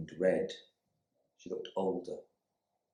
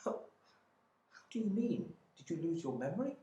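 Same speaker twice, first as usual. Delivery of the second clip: high, pleading voice of a shocked wife; frightened wife; 10 semitones higher, 5 dB quieter than usual. Acted. Wife confronting her missing husband, high and pleading. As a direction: stammer out her hurt to the husband back from the dead. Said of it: "Her terrified eyes".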